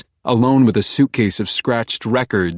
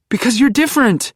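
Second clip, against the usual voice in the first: much higher, louder desperate plea; Beck pleading; 4 dB louder than usual. A narrator's reading. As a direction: beg him to stop. Beck flirting.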